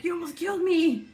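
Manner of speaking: loud high-pitched scolding; high mock scolding. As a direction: yell at the soup, pitched high. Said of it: "And I'm like".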